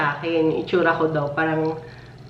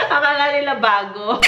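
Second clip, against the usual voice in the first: higher, through a giggle; amused, laughing line; 7 semitones higher than usual. Real, not acted. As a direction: still laughing as you say it. Said while laughing.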